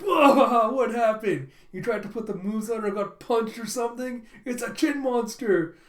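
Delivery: high, loud laughing exclaim; mock the bruise with glee; teasing amazement. Friend teasing Lloyd about his chin, laughing high.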